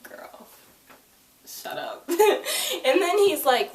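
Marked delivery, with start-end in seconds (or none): 0.0-2.0 breathy whisper, teasing; talking back to a show in a whisper; whispered retort. Retold or imitated. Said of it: "And I was like". 2.0-2.8 quick high burst of laughter. Real, not acted. Laughing at own joke.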